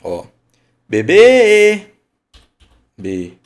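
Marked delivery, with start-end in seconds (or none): 0.9-1.9 much louder, higher "Bebê", last syllable held long; drawn-out call. Shown as a demonstration. Teacher shows where "Bebê" is prolonged, drawn-out call on the last syllable. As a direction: call out "Bebê" stretching the final ê. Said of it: "Onde ficou o prolongamento".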